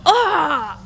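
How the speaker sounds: high, loud pained cry. Acted. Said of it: "And I go".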